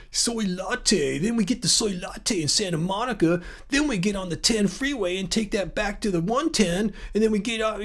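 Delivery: put-on California accent, slightly soft; exaggerated sketch-comedy California accent; Fred Armisen's Californians accent. Host spoofing the SNL skit's route through Santa Monica, mock California accent. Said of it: "doing the Fred accent on the Californian's".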